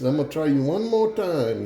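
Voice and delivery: high-pitched, lightly Cajun challenge; Cajun accent. Acted. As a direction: challenge him with a touch of Cajun. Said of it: "you guys wanna hear a Cajun joke".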